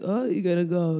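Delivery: lightly imitated teasing question; mocking driver. Uber driver making fun of a comedian passenger, lightly mocking. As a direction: tease the passenger with mild mock interest. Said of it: "trying to make fun of me".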